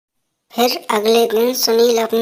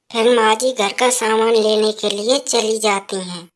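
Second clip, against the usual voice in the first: ordinary talk, nothing marked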